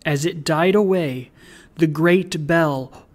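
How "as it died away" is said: usual speaking voice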